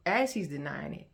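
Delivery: ordinary delivery, nothing marked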